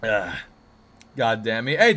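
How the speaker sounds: ordinary delivery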